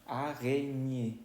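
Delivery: "Araña" as French a-ré-nié, slowly stretched; French pronunciation. Shown as a demonstration. Teacher modelling French word for spider, slow French sounds. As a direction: draw out "Araña" in French, ending in é. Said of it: "eso significa araña".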